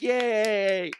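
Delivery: high, loud drawn-out cheer; imitated team cheer. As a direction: cheer like a crowd of kids. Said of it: "everybody's like".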